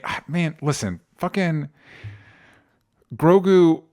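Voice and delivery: ordinary delivery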